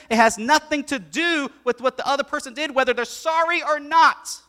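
loud, high emphatic preaching; fervent preaching. Acted. Pastor urging the congregation on forgiveness's true point. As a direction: drive the main point home.

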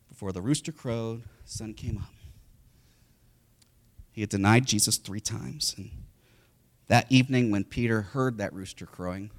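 ordinary talk

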